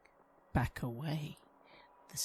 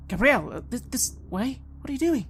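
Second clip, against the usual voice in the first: higher, louder, worried pleading; anxious calling out; 10 semitones higher, 8 dB louder than usual. Acted. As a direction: gently coax her back, nervous.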